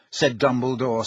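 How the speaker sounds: normal talking voice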